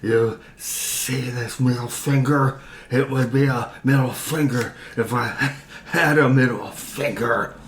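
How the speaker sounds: put-on slurry, loose drunken talk with a laugh. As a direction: sound drunk, laughing through it. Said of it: "in my own drunken voice".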